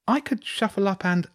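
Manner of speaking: put-on high-pitched small boy voice; young boy. A narrator's reading. Year-three Laurie offering Hugo space, in a high child voice.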